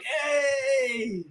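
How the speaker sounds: high, far-off sounding call; retelling a breezy goodbye to the Irishman; cheery mock farewell. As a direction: wave the drunk goodbye, hammed-up. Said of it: "And I was like".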